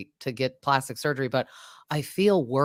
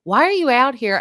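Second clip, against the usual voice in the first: higher and louder mock demand; mock indignant outburst; 9 semitones higher, 7 dB louder than usual. Recapping the fight, she mock-demands of Teresa loudly. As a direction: fire it back at her, half-acted. Retelling a confrontation.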